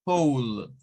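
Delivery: "Whole" with a long "ou" glide; long O sound. Shown as a demonstration. Teacher repeats after explaining, low "Whole" with long O. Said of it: "es un sonido largo".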